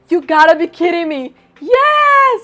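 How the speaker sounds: loud, high excitement bursting into a shrieked "Yes!"; thrilled disbelief. Acted. Winner of a trip to Los Angeles, thrilled shouting. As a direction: exclaim like a winner, then squeal in triumph.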